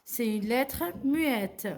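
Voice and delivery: ordinary talk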